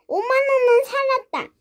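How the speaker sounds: high-pitched relieved cheer; 안도의 환호. Acted. 돈 절반을 지킨 안도. (높은 목소리로 안도하며 환호)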